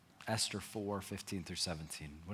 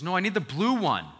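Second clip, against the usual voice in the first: son insisting; 10 semitones higher, 12 dB louder than usual. Retold or imitated. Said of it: "He goes".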